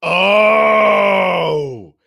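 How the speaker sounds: long, high, loud oh; exaggerated realization cry. Co-host learning the horseman is death, exaggerated loud oh. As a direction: draw out a big comic cry of revelation. Mock reaction to death reveal.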